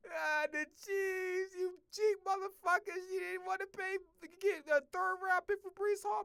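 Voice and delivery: high, slow mock whine at the Chiefs; whiny complaining fan. Imitating a fan bitching at the Chiefs, high slow whine. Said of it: "I'm gonna be bitching like".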